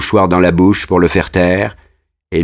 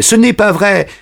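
"ce n'est pas vrai" much higher, near breaking; second "ce n'est pas vrai" climbing higher in panic; panicked denial; 12 semitones higher than usual. Acted. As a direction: push "ce n'est pas vrai" up into a frightened cry. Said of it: "en rougissant et en tremblant".

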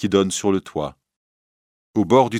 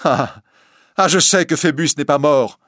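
triumphant taunt; 7 semitones higher, 5 dB louder than usual. Acted. Esmeralda taunts the priest.

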